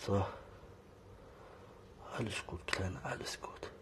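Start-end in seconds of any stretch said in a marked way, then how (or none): 2.2-3.7 hushed soothing whisper; calm her with a whisper. Real, not acted. Soothing a nervous kitten.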